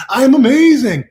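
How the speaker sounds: high, loud and exultant; exuberant affirmation. Real, not acted. Declaring his own worth, exuberant proclamation.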